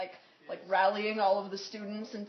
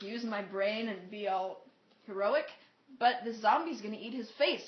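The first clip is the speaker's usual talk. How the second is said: normal talking voice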